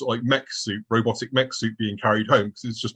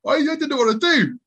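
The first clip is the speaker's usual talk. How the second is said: high-pitched, laughing admission; amused helplessness; 13 semitones higher, 3 dB louder than usual. Real, not acted. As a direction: squeak it out through amusement. Laughs at his own helplessness.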